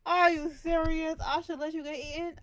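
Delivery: high, loud disbelief; outraged yell. Real, not acted. Outraged at character.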